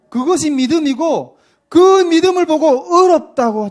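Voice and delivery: loud, high emphatic preaching. Real, not acted. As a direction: drive the point home with force. Preacher builds to emphatic point.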